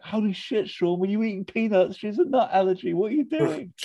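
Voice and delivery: very high, frantic mock panic; high panicked Liz. Liz squealing at Sean over the allergy. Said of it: "She's like".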